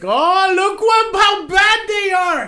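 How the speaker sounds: very high, loud, slow mock shock; mock outrage. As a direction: overdo the shocked indignation. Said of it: "Then it's like".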